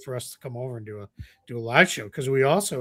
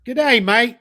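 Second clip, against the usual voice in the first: loud, high hammed-up Australian accent; loud mock Australian greeting; mock Aussie accent; 10 semitones higher, 6 dB louder than usual. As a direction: belt it like a cartoon Aussie. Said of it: "greetings from Nagoya, Japan".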